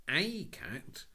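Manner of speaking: high, loud disbelieving question; mock disbelief; boy feigning surprise; incredulous. Cyril incredulous.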